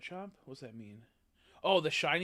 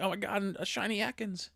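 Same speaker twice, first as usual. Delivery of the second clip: mock excited; 9 semitones higher, 5 dB louder than usual. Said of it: "I'm like".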